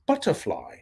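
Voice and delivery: "butterfly" in British English, crisp T and no R; regular British accent. Shown as a demonstration. Host showing chat how to say butterfly, regular British accent. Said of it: "just a regular British accent".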